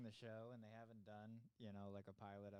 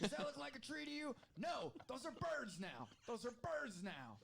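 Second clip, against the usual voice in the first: high, fast yelled challenge; drill sergeant; 11 semitones higher, 3 dB louder than usual. Pretend. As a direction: bark at a painting student like a drill instructor. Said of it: "It's like".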